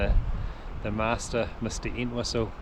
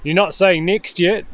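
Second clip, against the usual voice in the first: loud, high-pitched call to a buddy; raised voice carrying across water; 7 semitones higher, 8 dB louder than usual. Real, not acted. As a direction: shout over to the angler. Calling to angler mid-cast.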